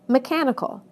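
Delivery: me-CHAN-ical, weight on CHAN; stress before the suffix. Shown as a demonstration. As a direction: punch CHAN in mechanical. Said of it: "stress moves right to the syllable".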